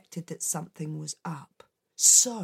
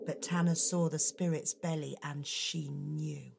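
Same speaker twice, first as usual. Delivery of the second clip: plain reading voice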